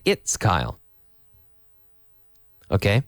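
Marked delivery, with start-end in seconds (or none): 0.0-0.8 "It's Kyle" said lower and falling; the answer to use, lower and settled; right form. Shown as a demonstration. Teacher showing the right way to answer the door. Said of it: "We say".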